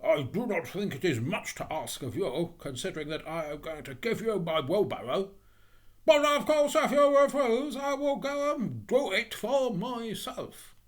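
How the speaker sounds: indignant, rising to a high, loud huff; guilt-trip a friend with wounded self-importance; affronted, pompous miller. Acted.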